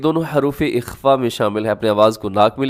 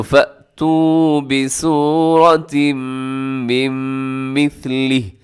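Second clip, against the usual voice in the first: Quran recitation; 2 semitones higher, much slower than usual. Shown as a demonstration. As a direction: recite it as in prayer. Recites the verse.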